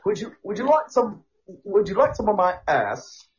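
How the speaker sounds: high-pitched mock-polite British; cheeky caricature Brit; British accent. Parodying the tea offer to co-host, British accent. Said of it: "the British asshole accent".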